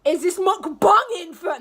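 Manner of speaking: high, loud and slow excited. Real, not acted. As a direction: get hyped, then catch yourself. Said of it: "let me chill out".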